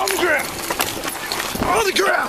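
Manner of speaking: urgent repeated shout; shouted surrender demands. Real, not acted. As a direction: yell it in alarm. Officers force him down.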